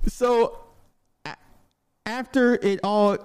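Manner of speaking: ordinary delivery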